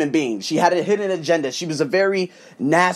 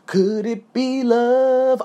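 dreamy awe; 4 semitones higher, much slower than usual. Retold or imitated. Dreamy quote at the airport.

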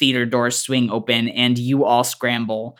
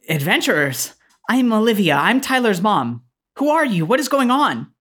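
higher, questioning mother voice; Tyler's mom; 8 semitones higher than usual. Acted. Tyler's mom questions the adventurers, puzzled. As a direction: address strangers in a high, swooping mom voice. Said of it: "This woman looks up at all of you and says".

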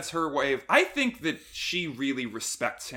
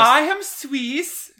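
mock Swiss villain; 13 semitones higher, 11 dB louder, much slower than usual. Cohost voices Zola mid-sentence.

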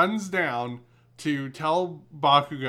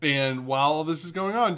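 ordinary talk, nothing marked

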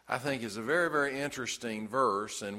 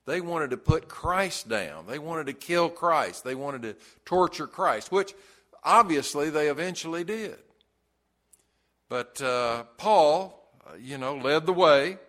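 louder, rising pulpit preaching; preaching at full force; 3 semitones higher, 4 dB louder than usual. Acted. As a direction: drive the point home to the congregation. Sermon climax, passionate.

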